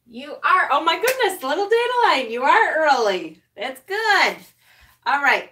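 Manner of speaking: normal talking voice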